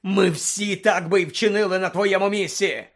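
loud, high, indignant bellow; raging outburst; furious roar. Acted. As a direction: roar the reproach at full force. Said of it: "заревів Блек".

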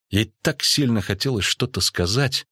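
plain reading voice

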